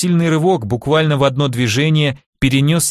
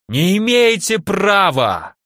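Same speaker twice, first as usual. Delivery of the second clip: yelling; 7 semitones higher, slower than usual. Acted. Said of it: "орал Наумов".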